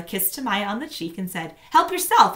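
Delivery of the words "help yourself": higher, louder mother voice; a hint of a busy mom; lightly voiced mother; mother's brisk offer. A narrator's reading. Mother home.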